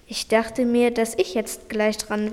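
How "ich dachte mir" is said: ordinary delivery, nothing marked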